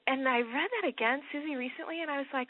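normal talking voice

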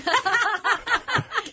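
bright burst of laughter; high laugh. Real, not acted. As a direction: laugh out loud, amused. Amused at his own realisation.

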